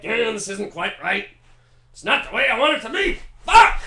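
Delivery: building from grumbling to a high, loud screamed curse; tortured composer losing it; fussy mutter rising into a shrieking swear; Beethoven frustration. Retold or imitated. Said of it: "And Beethoven's like".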